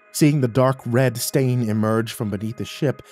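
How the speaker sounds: usual speaking voice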